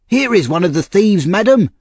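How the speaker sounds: a loud, high call up the stairs; shouting upstairs. A narrator's reading. Servant calling up the stairs to madam, loud shout. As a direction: call up to the lady, full voice. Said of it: "he shouted up the stairs".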